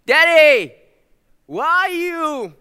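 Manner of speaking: put-on high, drawn-out little girl's call; toddler calling out. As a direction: call out sweetly like a two-year-old looking for daddy.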